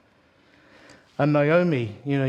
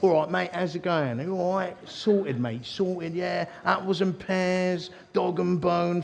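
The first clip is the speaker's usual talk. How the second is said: higher-pitched mock Cockney mate; 5 semitones higher than usual. Preacher imitating his Cockney friend, broad Cockney. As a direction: put on a broad Cockney patter. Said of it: "was such a cockney".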